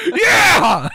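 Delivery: high, very loud whoop; triumphant yell. Retold or imitated. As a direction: cheer like you just scored. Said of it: "I was like".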